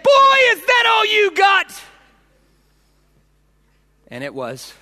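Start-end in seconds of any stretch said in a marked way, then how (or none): 0.0-1.8 high, loud defiant taunt; angry kid daring his opponent; taunting shout. Retold or imitated. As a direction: bellow it at him, unhurt and furious. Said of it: "And he said".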